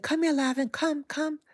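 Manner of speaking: high, loud call across the room; retold high call to a dog that can't hear. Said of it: "but when I'm calling".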